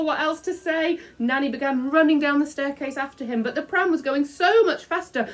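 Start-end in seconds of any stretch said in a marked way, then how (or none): none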